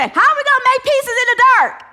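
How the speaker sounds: high, loud frantic wail; panicked cry. Retold or imitated. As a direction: cry out lost in the dark. People panicking in blackout.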